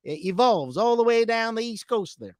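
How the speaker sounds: high-pitched Texan twang; Texas accent. Shown as a demonstration. Ending the accent tour in Texas, high Texan twang. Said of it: "people in Texas talk like that".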